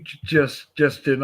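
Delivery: ordinary delivery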